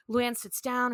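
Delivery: ordinary talk, nothing marked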